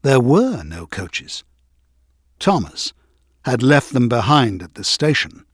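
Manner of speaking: plain reading voice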